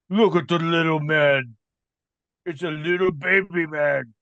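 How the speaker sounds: lightly voiced mocking brute; a hint of monstrous sneering; taunting monster head. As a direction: taunt the intruder like an ogre. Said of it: "the one that went, "Oh, you there".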